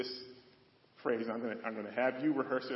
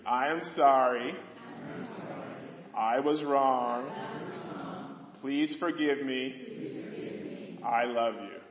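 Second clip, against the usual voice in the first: louder, slow, leading the congregation; louder call to repeat; 5 dB louder, much slower than usual. Shown as a demonstration. Pastor leading congregation to repeat, louder slow call. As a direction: lead them through it louder, like a call-and-response. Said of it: "repeat that after me".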